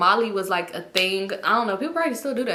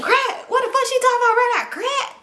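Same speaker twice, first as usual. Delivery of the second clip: very high, rushed mock-outraged mumbling; mumble-mouthed homeboy; 13 semitones higher, 3 dB louder than usual. Homeboy reacting to the crack accusation, high mock mumbling. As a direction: squawk it fast, imitating a garbled friend. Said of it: "What the fuck is Mumble Mouth saying".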